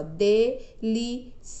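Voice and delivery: "delicioso" broken into de, li, ci, o, so; syllable by syllable. Shown as a demonstration. Teacher separating a word for pupils, "delicioso" syllable by syllable. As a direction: say "delicioso" slowly, pausing after each syllable. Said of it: "ao separarmos".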